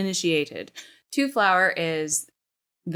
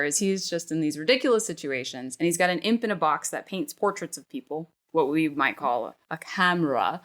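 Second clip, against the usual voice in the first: normal talking voice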